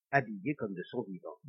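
usual speaking voice

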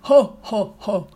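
loud ho ho ho from a big beast; booming crocodile laugh. A narrator's reading. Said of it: "éclata d'un si grand rire". (gros rire sonore de crocodile)

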